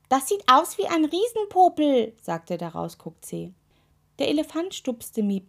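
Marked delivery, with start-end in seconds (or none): 0.1-2.1 high, loud cheeky toe voice; blurting toe. A narrator's reading. Toe spoiling the awed moment, cheeky high blurt.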